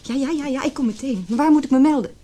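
high, rushed and urgent; hurried panic; flustered. Acted. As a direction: scramble to get the details. Hurrying to the hospital by phone.

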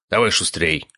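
brusque, mild hurry-up; barked order. A narrator's reading. Father rushing son into the house, barking. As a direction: hurry him along curtly. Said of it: "рявкнул на него отец".

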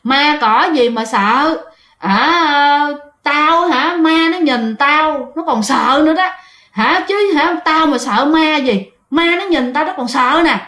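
slow tipsy swagger; acted drunken bravado, drawn out; drunk man. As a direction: brag like a drunk, dragging the words out. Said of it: "thì diễn tả lại y chang luôn á".

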